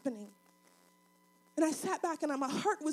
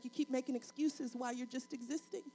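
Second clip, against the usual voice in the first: God's soft rebuke; 4 semitones lower, 10 dB quieter than usual. Acted. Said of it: "And God is like".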